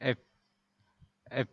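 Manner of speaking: এফ with the air pushed out hard; teacher modelling F. Shown as a demonstration. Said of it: "উপরের দাঁতের সাথে নিচের ঠোঁট স্পর্শ করবে". (ঠোঁট দাঁতে ছুঁয়ে জোরে হাওয়া দিয়ে এফ)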